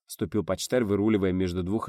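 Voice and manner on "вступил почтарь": plain reading voice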